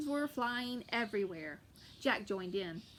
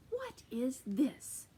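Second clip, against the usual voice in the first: slow menacing question; much slower than usual. Acted. Giant holding Jack.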